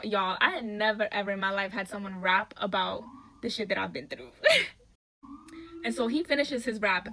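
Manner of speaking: usual speaking voice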